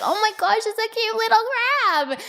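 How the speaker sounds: put-on very high, drawn-out little-girl delight; Phoenix. Mother imitating daughter finding a crab, delighted child. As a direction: coo excitedly in a child's voice. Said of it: "And Phoenix is like".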